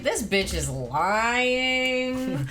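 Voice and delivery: hugely drawn-out accusation; mock accusing politician. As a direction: stretch the last word out as mockery. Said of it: "he was like".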